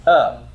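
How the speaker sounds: "Uh" as a bare reduced vowel. Shown as a demonstration. Teacher answering how the first syllable is pronounced, reduced "Uh". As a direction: give just the unstressed "Uh" sound that starts "about". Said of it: "how do you pronounce the first syllable".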